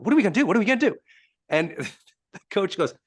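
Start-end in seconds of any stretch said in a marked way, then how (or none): 0.0-1.0 higher-pitched mock panic; acted freaking out. Quarterback to his coach after the touchdown, acted freaking out. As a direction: repeat it urgently, imitating someone losing it. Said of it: "Just freaking out".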